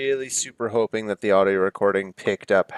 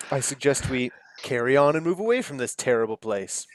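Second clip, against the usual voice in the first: usual speaking voice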